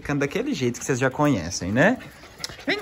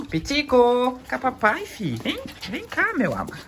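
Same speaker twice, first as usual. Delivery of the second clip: sing-song higher voice calling the puppy over; high coaxing pet talk; 7 semitones higher, slower than usual. Real, not acted. Owner coaxing his puppy onto his lap, high sing-song coo. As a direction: coo to coax the pup closer.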